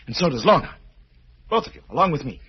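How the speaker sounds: menacing threat. Acted. Roger threatens to kill the surgeon and Lorna, menacing. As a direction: make him feel the gun.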